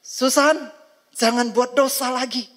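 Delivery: high, loud stern rebuke. Acted. God sternly warns Susan a second time, loud and high. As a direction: raise the voice and scold her. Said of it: "Tuhan akan berbicara lebih keras".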